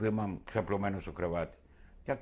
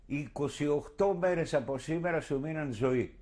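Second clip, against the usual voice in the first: calling out; 4 semitones higher than usual. Retold or imitated. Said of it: "φωνάζω".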